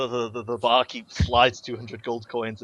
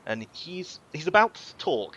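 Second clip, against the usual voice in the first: ordinary talk, nothing marked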